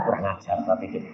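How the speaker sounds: usual speaking voice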